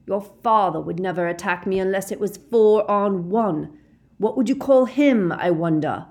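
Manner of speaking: loud, rising into scornful questioning; shouted taunt. A narrator's reading. Snape mocking Harry's father, shouting. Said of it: "shouted Snape".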